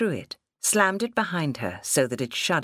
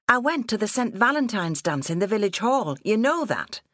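put-on higher-pitched protesting young woman; indignant daughter; 7 semitones higher than usual. Daughter protesting at being locked out, indignant. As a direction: argue back with rising indignation.

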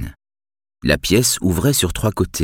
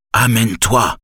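curt old man's order, lightly voiced; commanding tone; 5 semitones higher than usual. A narrator's reading. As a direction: give a gruff command. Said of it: "marmonna-t-il sur un ton de commandement".

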